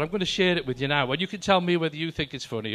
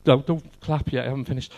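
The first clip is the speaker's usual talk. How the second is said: usual speaking voice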